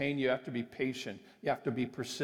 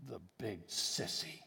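shaky little voice; 4 semitones lower, 7 dB quieter, slower than usual. A narrator's reading. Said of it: "a little shaky voice".

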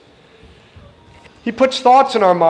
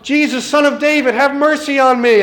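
crying out; 6 semitones higher than usual. Retold or imitated. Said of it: "And he cries out".

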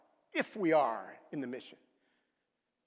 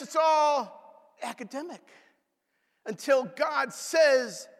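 high shout building to God's words; shouted climax; 9 semitones higher, 4 dB louder, slower than usual. Real, not acted. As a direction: cry it out, then slow down hard.

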